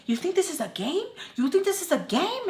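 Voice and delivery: mock mom's outraged question; mom yelling. Mom accusing her of playing games, high mock outrage. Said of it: "screaming at me".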